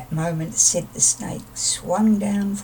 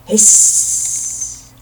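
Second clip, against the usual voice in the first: a long louder hiss with no voice; snake hiss; 9 dB louder than usual. Shown as a demonstration. Sid showing Owl his hiss, long snake hiss. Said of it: "I can hiss".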